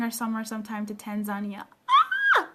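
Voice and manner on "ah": delighted squeal. Real, not acted. Excited squeal at Tanzania.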